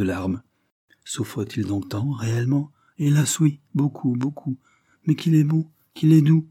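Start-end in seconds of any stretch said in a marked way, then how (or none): 3.0-6.5 put-on higher, heartfelt child's voice; earnest little girl. A narrator's reading. Little girl tells visitor of her suffering friend, earnest pity. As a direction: lament and praise like a devoted child.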